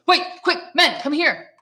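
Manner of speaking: guard calls his men to grab the prisoner; calling out. Acted. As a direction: shout to the other men. Gendarme calls out for help.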